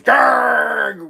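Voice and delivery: drawn-out growling dog noise; long mock snarl; imitated dog growl. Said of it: "growling at me".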